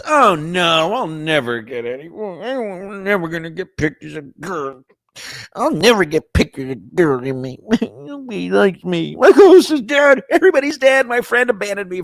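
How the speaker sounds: high, loud hammed-up whine of self-pity; mock whining. Streamer whining to Steph in chat, hammed-up. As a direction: wail like a sulking kid.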